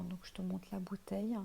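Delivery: usual speaking voice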